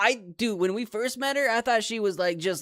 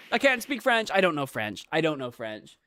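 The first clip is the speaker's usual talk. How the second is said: ordinary talk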